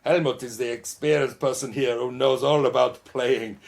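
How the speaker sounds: hammed-up German accent butting into the interview. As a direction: play Helmut, a jolly Bavarian bandleader, with a German accent. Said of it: "Yeah, Helmut gets in the way".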